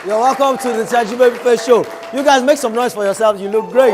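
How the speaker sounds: rally the crowd with a big welcome; shouting welcome. Real, not acted. Host greets studio audience.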